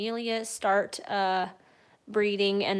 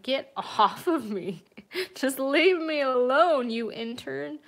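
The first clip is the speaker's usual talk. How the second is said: 1 voicing the annoyed cat; 5 semitones higher, 5 dB louder than usual. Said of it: "And Miranda's like".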